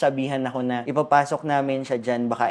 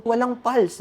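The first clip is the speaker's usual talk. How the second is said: much higher, tense urgent question; 10 semitones higher, slower than usual. Retold or imitated. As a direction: relive the alarm of the moment. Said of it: "Tapos sabi ko". (nagmamadali at kinakabahang tanong)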